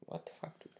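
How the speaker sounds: breathy aside under the breath; disbelieving whisper. Real, not acted. Vlogger stunned by a client's emails, whispering in disbelief.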